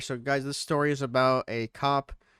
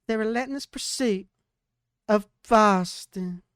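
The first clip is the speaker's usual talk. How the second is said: dramatic title read; 6 semitones higher, 4 dB louder than usual. Shown as a demonstration. Dramatic title read for the podcast crew. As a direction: announce the title like a movie trailer. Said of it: "I'll do the voice too".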